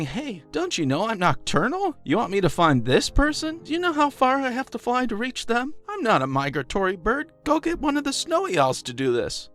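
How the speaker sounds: high-pitched, put-out owl voice; mock protest, pitched well up; indignant owl. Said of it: "It's as though the owl is saying".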